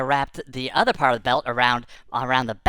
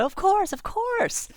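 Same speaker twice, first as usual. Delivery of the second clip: put-on much higher squeaky child voice; kid voice; slower than usual. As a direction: squeak it like a small proud kid. Kid answers the customer.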